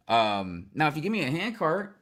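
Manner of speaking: ordinary delivery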